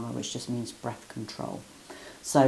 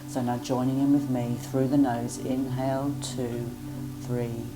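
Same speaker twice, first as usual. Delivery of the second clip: guided breath counting; much slower than usual. Acted. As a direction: count each breath evenly and calmly.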